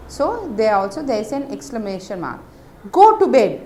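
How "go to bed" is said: loud, higher, harsh order; cross mother. Shown as a demonstration. Teacher voices a cross mother sending a child to bed. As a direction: scold like an angry mother. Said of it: "with a harsh voice because she is cross".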